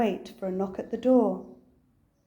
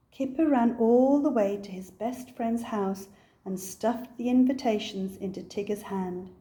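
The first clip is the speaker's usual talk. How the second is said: usual speaking voice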